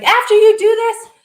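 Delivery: high, loud imitation of a thrilled commenter; mock excited exclamation. Viewers commenting after her session, imitated excitement. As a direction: burst out like an energized fan.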